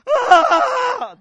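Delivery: loud, high open-mouthed wail; bawling wail. Retold or imitated. Preacher showing how hard he wept, loud bawling. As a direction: let out a big demonstrative bawl. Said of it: "That kind of cry".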